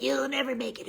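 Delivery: high, cruel taunting voice; demonic inner voice. Retold or imitated. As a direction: sneer like a nasty inner critic. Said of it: "how demonic my voice".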